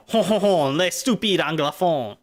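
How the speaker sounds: French-accented taunt with a mock chortle; imitated French-speaking cousins. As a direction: mimic scoffing cousins in a hammed-up French accent. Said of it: "cousins would say".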